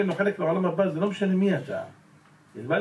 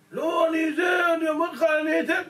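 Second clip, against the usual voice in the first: pleading worker; 11 semitones higher, 3 dB louder than usual. Retold or imitated. Worker begging the boss for a chance, high pleading shout. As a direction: plead hard like a desperate worker.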